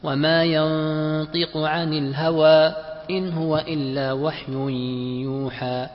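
slow tajweed chant; Quranic recitation. Shown as a demonstration. Student reads a verse example in class, Quranic recitation. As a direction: recite with long held vowels and measured pauses.

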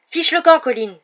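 high, loud, exasperated outburst; angry shout. A narrator's reading. Harry chasing Colin away from Ron, angry shout. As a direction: yell at him to clear off. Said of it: "s'écria Harry avec colère".

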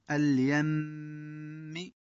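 اليم slow, doubled م hummed; nasal ghunna. Shown as a demonstration. Teacher demonstrates ghunna on the doubled mim. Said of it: "الوقف على النون والميم المشددتين بالغنة".

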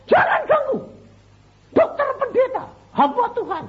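very high, loud retold call; imitating a distant rescuer's high yell; shouting from afar. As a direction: call out a warning across a distance. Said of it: "Saya teriak gitu, ada orang satu di, dari, uh, jauh".

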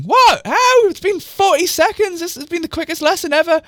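very high-pitched squawk of disbelief; loud and high, then rushing in disbelief; incredulous outburst. Real, not acted. Reader baffled by forty-second lesson, incredulous high outburst.

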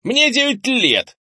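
put-on very high, loud, drawn-out child's protest; indignant boy. A narrator's reading. Nine-year-old talks back to grandfather, indignant. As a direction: squeal it back in outrage. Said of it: "в ответ возмутился Кенни".

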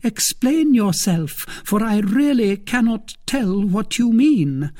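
high, urgent demand; agitated, high-pitched insistence; anxious. A narrator's reading. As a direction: press anxiously in a high woman's voice. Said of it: "in an anxious tone".

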